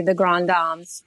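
GRAND DAMES as "grahn dahms"; French-style "Grand Dames", Dames like "psalms"; French pronunciation. Shown as a demonstration. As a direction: pronounce Grand Dames as in France, with open "ah" vowels. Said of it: "if we were in France".

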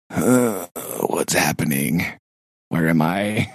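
low, groggy-brained befuddlement; mock being lost on a first day back; dazed confusion. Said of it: "Monday was like".

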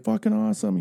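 high, loud whoop of praise; excited cheer. Retold or imitated. A guy cheering the kid's catch, high excited whoop.

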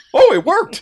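high, loud burst of triumph; amazed self-congratulation. Acted. Metatron marvels at his own code working, amazed and triumphant. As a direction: shout it like you pulled off a miracle. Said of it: "acts like he did this amazing thing".

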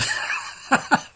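short burst of laughter; amused laugh at a guess; quick real laugh. Real, not acted. Amused by co-host's college guess.

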